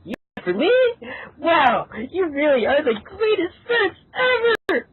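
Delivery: high, mock-grateful sarcasm; sarcastic. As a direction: gush falsely, pitched high. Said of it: "[name] said sarcastically".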